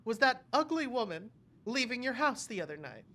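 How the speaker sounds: lightly voiced cartoon bunny; Lola Bunny voice. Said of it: "Lola's like".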